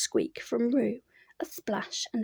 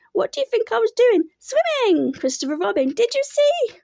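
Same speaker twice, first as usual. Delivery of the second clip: high, loud squeaky boast; excited squeak; 10 semitones higher, 6 dB louder than usual. A narrator's reading. Roo boasts to everyone, excited squeak. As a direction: squeal it with pride.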